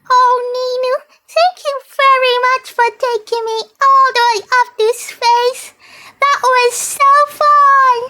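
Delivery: high, fast, delighted character voice; high excited thanks. Pretend. Thanking Ninu after space ride.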